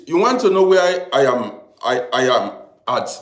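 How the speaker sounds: ordinary delivery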